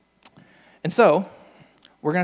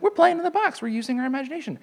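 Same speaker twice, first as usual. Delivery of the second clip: much higher, squeaky cartoon voice; cheery SpongeBob; 11 semitones higher, faster than usual. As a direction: play a gleeful childlike sponge. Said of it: "He's like".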